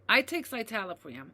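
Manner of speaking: ordinary delivery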